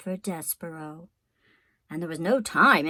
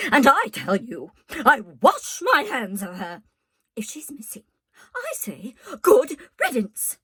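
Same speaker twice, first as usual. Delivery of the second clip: shouting; 4 semitones higher, 5 dB louder than usual. A narrator's reading. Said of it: "Louise was shouting".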